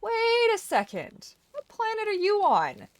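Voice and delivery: high, slow, loud disbelief; what planet are you on, aghast; incredulous outburst. Retold or imitated. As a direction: stretch it out in mock outrage. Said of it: "And it's like".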